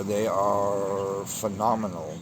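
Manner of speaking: ordinary delivery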